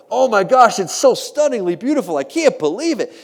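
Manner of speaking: high, loud mock gushing; gushing admirers. Museum crowd fawning over an ugly painting, gushing. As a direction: overdo the awe, loudly and high-pitched.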